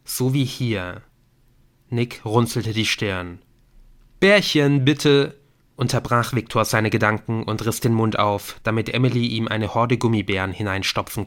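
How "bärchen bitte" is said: higher, louder request; pleading call. A narrator's reading. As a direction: call out for a treat. Character demands gummy bears, interrupting. (fordernde Bitte)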